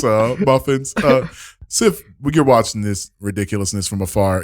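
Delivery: ordinary talk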